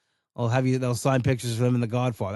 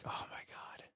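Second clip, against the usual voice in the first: whispered; 22 dB quieter than usual. Retold or imitated. Exasperated whispered aside.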